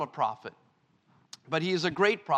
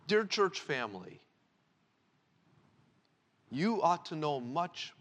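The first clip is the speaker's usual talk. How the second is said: usual speaking voice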